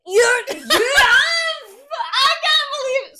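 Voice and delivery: laughing, high-pitched disbelieving shout; shrill shout. Real, not acted. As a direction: shriek it in disbelief. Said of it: "no, that's quite shrill".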